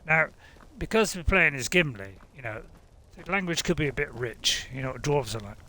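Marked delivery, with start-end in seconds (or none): none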